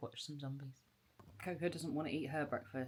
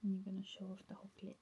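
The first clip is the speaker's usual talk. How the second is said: whispering; 4 semitones higher, faster than usual. Real, not acted. Whispering to the hedgehog.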